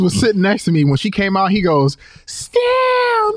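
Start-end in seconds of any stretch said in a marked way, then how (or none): 2.2-3.4 very high, bass-less fanboy squeal; fangirling falsetto. Retold or imitated. Friend imitating a smitten man spotting Stamp, fangirling high voice. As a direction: imitate a starstruck man, voice shot way up. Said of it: "Where the bass go in your voice?".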